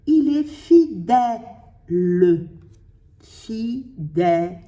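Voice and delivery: "fidèle" slowly cut into "fi", "dè", "le"; syllable by syllable. Shown as a demonstration. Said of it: "une syllabe entière avec un".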